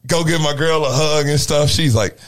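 usual speaking voice

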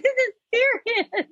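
high, softened fake crying voice; hammed-up tearful plea; mock sobbing. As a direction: imitate someone wailing through tears. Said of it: "like, sobbing".

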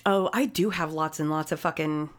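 ordinary talk

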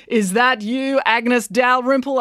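high, slow, projected question; calling out. A narrator's reading. Brad to elderly lady who threw stick, calling out. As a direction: call across a crowd to someone you know. Brad calls to woman in crowd.

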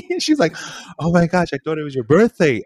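high giggly retelling; laughing. Real, not acted. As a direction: laugh through the story.

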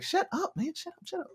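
hushed aside. Retold or imitated. Talker hushing his gang away from the mic, high hushed shush. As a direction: shush the gang beside you.

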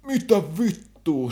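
high, loud and slow mock yell; mock angry yell. Drunk man in the shop yelling at staff, slow loud mock yell. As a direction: drag out the swear like a raging drunk.

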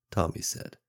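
ordinary delivery

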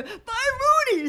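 very high, sing-out goodbye; mock calling out. Co-host calling to a coworker leaving the car park, mock holler. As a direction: imitate hollering bye across a distance.

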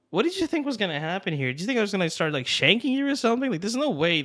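high-pitched, incredulous protest; indignant retort. Retold or imitated. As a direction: challenge him as if the idea is absurd.

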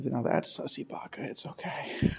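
a teasing whisper from the eavesdropper; hushed aside. Pretend. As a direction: sneak it in like someone hiding behind a bush. John eavesdropping behind a bush.

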